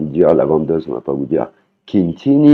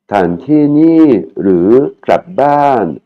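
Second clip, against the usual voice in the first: very slow, raised Thai phrase; slow vendor question; 6 semitones higher, much slower than usual. Shown as a demonstration. Teacher voicing the vendor's question to students, slow and high. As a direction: spell out each syllable slowly for learners.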